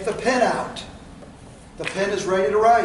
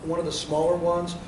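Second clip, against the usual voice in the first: quiet trial close; 5 semitones lower, 8 dB quieter than usual. Shown as a demonstration. Salesman demo to couple.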